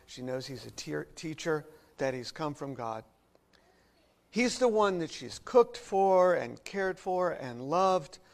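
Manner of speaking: normal talking voice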